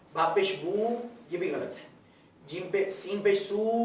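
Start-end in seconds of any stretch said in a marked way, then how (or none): 2.9-3.7 سو with its vowel drawn out too long; slow demo of the lengthened سو; wrongly stretched vowel. Shown as a demonstration. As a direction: drag out the سو on purpose to show the mistake. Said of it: "یہ آواز کو لمبا کرنا یہ غلط ہے".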